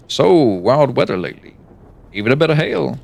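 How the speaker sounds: coffee shop small talk. Pretend. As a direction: play a cheery coffee-shop regular. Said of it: "just like a coffee shop person".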